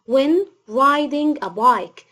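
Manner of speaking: very slow, word by word; slow dictation. Shown as a demonstration. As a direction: read it out slowly for pupils to copy. Teacher dictating example sentence.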